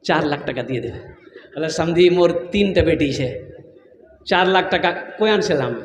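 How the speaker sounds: low, soft acted plea; meek pleading father. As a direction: plead quietly as a cornered bride's father. Acted dowry haggling, bride's father pleads.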